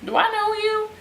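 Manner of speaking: high, squeaky questioning; mock puzzlement. Fan spotting familiar actor, puzzled high voice. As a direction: ask it high, as if half-recognizing. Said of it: "and I was like".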